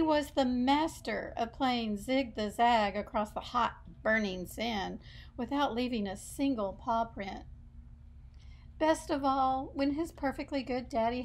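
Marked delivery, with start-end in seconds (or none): none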